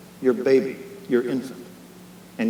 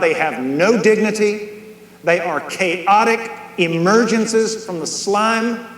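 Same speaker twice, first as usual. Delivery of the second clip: rising, louder and higher, each "no such thing" hammered; retold old preacher's zeal, pounding every phrase; livid preaching; 6 semitones higher, 4 dB louder than usual. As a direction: build the quote to a livid preaching peak. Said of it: "he would get livid".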